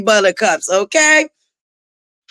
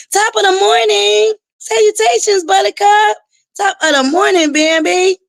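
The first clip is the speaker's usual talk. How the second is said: much higher, sing-song hello to the chat; high singsong greeting; 9 semitones higher than usual. Real, not acted. Host greeting chat viewers in a much higher singsong. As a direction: lift it up high and sweet, like a cheery host.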